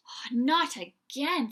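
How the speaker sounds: slow, loud exasperated groan. Acted. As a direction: sigh it out, fed up. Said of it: "said a voice".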